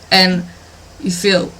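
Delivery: ordinary delivery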